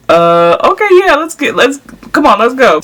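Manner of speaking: high, eager, rushing ahead; pumped up, let's do this now; excited, ready to go. Retold or imitated. Told the baby's head is visible.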